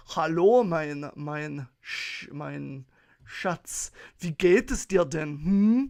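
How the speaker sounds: halting, uneven mock-German greeting; German accent. Shown as a demonstration. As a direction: put on German, punching the hello and final hum. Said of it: "German, not so much".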